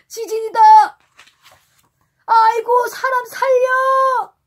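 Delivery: high, loud terrified cry, slowing down; panicked scream. Acted. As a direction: scream for help in terror. Sailors panic as island shakes. (겁에 질린 비명)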